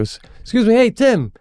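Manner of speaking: high-pitched re-enacted hail; calling out. Dad calls down from the bleachers to the coach, calling out. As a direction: get his attention with a lifted voice.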